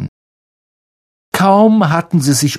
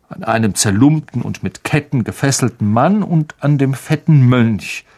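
ordinary delivery

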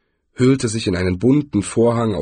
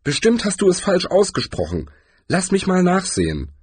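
higher-pitched woman's voice; lightly voiced woman, pitched up; woman character; 9 semitones higher than usual. A narrator's reading. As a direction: point out the mistake in a high female character voice. Woman taking over the spell book.

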